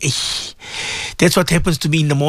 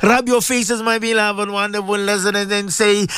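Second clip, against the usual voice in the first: sing-song supplication with long chanted ameens; swaying, chant-like congregational prayer; fervent prayer leading; 2 semitones higher than usual. Real, not acted. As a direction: lead listeners in heartfelt dua, stretching the holy words.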